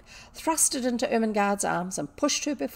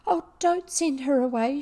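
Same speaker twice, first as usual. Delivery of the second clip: higher, louder tearful begging; sobbing plea; 7 semitones higher, 6 dB louder than usual. A narrator's reading. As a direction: plead for your friend through tears. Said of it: "she sobbed".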